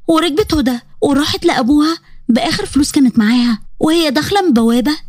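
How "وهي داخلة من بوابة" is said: ordinary delivery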